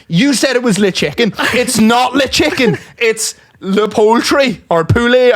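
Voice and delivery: high, slow, emphatic mimicry; imitated indignant correction. Coworker correcting teller's French chicken, indignant. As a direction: correct them point by point, mock-indignant.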